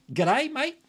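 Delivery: high, playful attempt at an Australian accent; put-on Australian. Greeting a viewer down in Oz, put-on Australian accent. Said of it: "trying to put on Australian voice".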